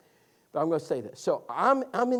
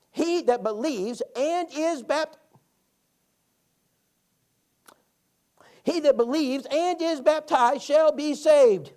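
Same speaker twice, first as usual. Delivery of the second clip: slow, higher, loud reading one word at a time; 8 semitones higher, 8 dB louder, slower than usual. Retold or imitated. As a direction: spell out each word as an old lady reading carefully. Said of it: "read one word at a time".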